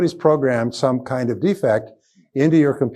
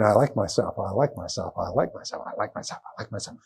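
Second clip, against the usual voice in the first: repeated, softer each time until whispered; fading to a whisper; 4 semitones lower, 10 dB quieter than usual. Shown as a demonstration. Speaker showing repetition fading from soft to whispered. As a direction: let it fade into a whisper. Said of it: "keep repeating".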